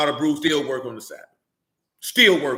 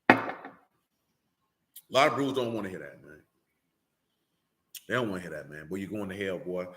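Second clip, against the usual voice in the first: real low, quiet aside, pitch sinking; 9 semitones lower, 5 dB quieter than usual. Real, not acted. Preacher remarking that brews don't wanna hear it, low aside. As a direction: drop the voice right down, almost to yourself. Aside after an unwelcome warning.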